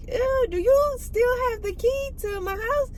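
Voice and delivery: high, slow, disgusted mock read; ew-ing at him, high and drawn out; grossed-out text. Texting ex about the key.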